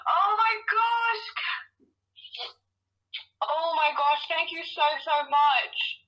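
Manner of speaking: overwhelmed and tearful, words coming haltingly; tearful joy. Real, not acted. Winner thanking the host on hearing she has won, tearful. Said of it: "I can feel you crying through the phone".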